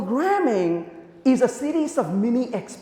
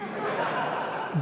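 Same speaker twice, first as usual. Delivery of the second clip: breathy laugh; 9 semitones higher, 10 dB quieter than usual. Real, not acted. Speaker laughs at the story.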